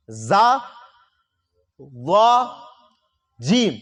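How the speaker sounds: زا، وا، جیم ہر حرف الگ واضح آواز میں; تینوں حروف کا فرق سنائیں; three letters contrasted. Shown as a demonstration. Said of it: "یہ تینوں الگ ہیں". (حروف کا فرق واضح کرتے ہوئے)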